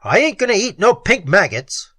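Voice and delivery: sulky thug refusing his food; tough guy Spike. Acted.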